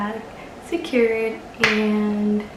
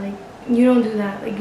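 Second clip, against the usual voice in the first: ordinary delivery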